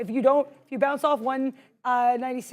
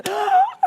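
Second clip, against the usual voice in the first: laughing gasp; 4 dB louder than usual. Real, not acted. Mock gasp at own joke.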